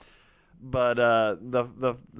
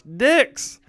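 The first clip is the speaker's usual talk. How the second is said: much higher, louder one-word taunt; teasing sing-song; 12 semitones higher, 7 dB louder than usual. Retold or imitated. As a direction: toss it out to the next sibling. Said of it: "go around Josh".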